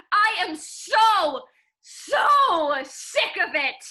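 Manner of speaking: slow, heavy stress on so; emphatic. Acted. Janie telling Nick she's done, slow and emphatic. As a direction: lean hard into every word.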